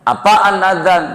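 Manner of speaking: high, curious little-calf voice; calf's voice. Acted. Calf asking its mother, high curious voice.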